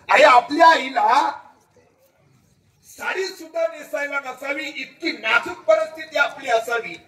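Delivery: high, loud lament for his mother; anguished outcry. Acted. Preacher crying out to the crowd about his mother, pained loud lament.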